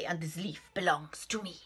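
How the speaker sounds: hushed, menacing rat; sinister whisper. Acted. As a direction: whisper the threat. Rat's menacing finish.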